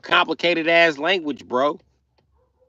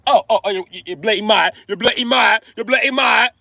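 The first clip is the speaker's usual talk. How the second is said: higher, stammering mock Brit; British accent; 6 semitones higher, 3 dB louder than usual. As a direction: sputter indignantly in a British accent. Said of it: "The British made up that word".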